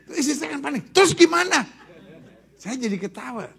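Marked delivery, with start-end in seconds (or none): 0.9-1.7 high, loud panicked question; panicked wife. Retold or imitated. Wife asking her husband what now, panicked. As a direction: blurt it out in a panic.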